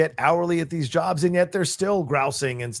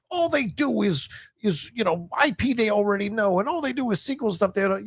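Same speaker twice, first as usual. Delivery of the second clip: high, whiny mock complainer; nasal-free but high mocking whine; mocking grouser; 8 semitones higher than usual. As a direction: sneer it like a smug critic. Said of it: "those grousers on the side who are like".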